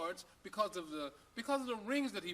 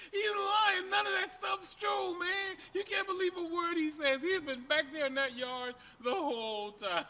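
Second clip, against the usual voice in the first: high, loud exasperated shouting; high loud outburst; laughing shout; 10 semitones higher, 6 dB louder than usual. Acted. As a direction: yell it out, still half laughing. Owner shouts, still laughing.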